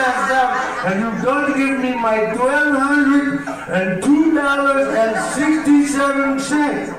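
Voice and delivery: put-on high, drawn-out Indian-accented demand. Indian landlord demanding money through the door, Indian accent. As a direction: sing out the threat in an Indian accent. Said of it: "I know you heard me do an accent".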